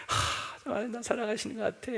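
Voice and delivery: soft, fast, breathy delight; contented sigh. Retold or imitated. Prosperous believer sighing contentedly, soft and quick. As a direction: sigh with satisfaction. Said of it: "막 승승장구하고 하면".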